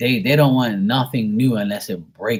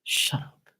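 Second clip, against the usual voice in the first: low-volume, whispered dismissal; muttered shut-up; 6 dB quieter than usual. Retold or imitated. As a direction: say it under the breath, fed up. Imagined reply to complaining brother.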